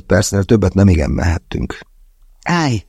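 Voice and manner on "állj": sharp high command to stop; commanding halt. A narrator's reading. Andrei orders the group to stop, sharp command. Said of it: "vezényelt Andrei".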